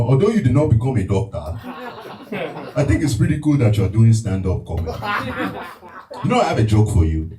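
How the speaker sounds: Nigerian dad impression, proud and a little pompous; a touch of a formal Nigerian father; impression of his father. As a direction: play the proud African father. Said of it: "the impression of your father".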